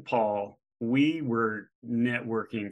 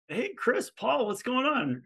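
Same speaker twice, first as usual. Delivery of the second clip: much higher, bright hello to friends; pitched-up friendly hail across the room; cheery greeting; 12 semitones higher, slower than usual. Retold or imitated. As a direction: call out happily to old acquaintances.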